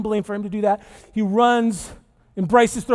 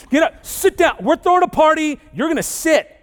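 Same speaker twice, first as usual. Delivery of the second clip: higher, louder mock-stern orders; stern parent; 7 semitones higher, 5 dB louder than usual. Parent ordering the returned kid around, loud and mock-stern. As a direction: lay down the law, half joking.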